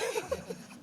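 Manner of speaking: short high nervous giggle. Real, not acted. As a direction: giggle through the nerves. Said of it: "Sorry, I'm nervous".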